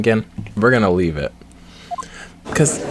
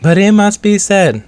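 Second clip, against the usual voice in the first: flat robotic voice, higher pitch; 9 semitones higher, 5 dB louder than usual. Retold or imitated. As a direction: speak like a stiff robot. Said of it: "she has a robot voice".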